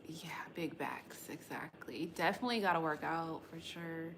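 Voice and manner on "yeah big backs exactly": soft unvoiced whisper. Real, not acted. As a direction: murmur agreement under the breath. Agreeing with chat aside.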